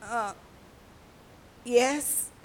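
groggy, halting reply; woken from sleep. Acted. Father who fell asleep answering his daughter, groggy. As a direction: answer as if just roused. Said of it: "'cause Daddy had fallen asleep too".

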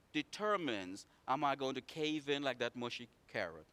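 usual speaking voice

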